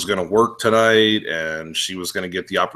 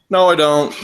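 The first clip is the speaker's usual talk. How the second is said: louder, higher firm rebuttal; emphatic refusal; 5 semitones higher, 5 dB louder than usual. Acted. Told he must choose, an emphatic refusal. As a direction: push back hard and loud.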